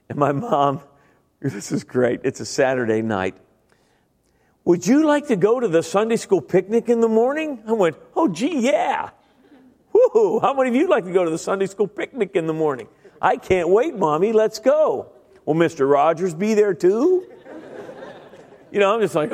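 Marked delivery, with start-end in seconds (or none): 4.6-7.6 higher, sugary invitation; much higher singsong question; sweet mom. Retold or imitated. 8.1-17.3 high, gushing mock enthusiasm; much higher sarcastic glee; sarcastic fake excitement.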